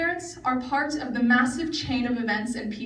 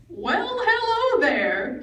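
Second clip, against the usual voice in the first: slow, much higher Southern greeting, lightly imitated; southern twang; 9 semitones higher, much slower than usual. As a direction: drawl it like a Southern grandpa. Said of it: "he said in his southern twang".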